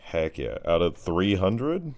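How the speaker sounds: ordinary talk, nothing marked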